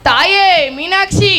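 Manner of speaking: slow, loud plea; calling to goddess. Acted. Poet begging goddess Meenakshi, slow loud plea. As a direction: cry out slowly to the goddess.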